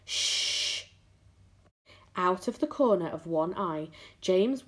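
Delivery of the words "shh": drawn-out voiceless shush; long shushing hiss. A narrator's reading. James hushing the earthworm, long shush. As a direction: hush them with a long shh.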